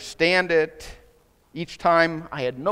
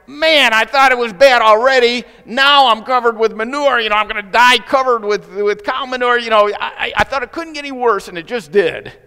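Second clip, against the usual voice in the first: a much higher, louder little bird voice, wailing at its bad luck; frightened little bird; 7 semitones higher, 10 dB louder than usual. Freezing bird covered in manure, crying out in a high, loud voice. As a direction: play the doomed bird, loud and high, in mock despair. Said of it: "And the bird is going".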